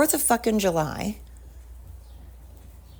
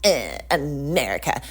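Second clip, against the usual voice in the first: mock disdain; 4 semitones lower than usual. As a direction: sneer it like a smug killjoy. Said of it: "you can be like".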